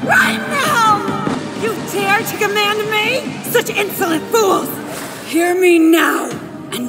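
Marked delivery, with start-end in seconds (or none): 0.1-1.0 angry shout. Acted. Villain demanding, raging.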